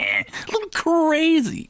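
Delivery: high, drawn-out mock-crazy squeal; hammed-up loony singsong; playful crazy voice. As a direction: stretch it out high and zany. Playfully echoes host's teasing remark.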